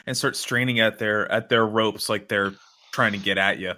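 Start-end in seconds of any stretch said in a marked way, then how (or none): none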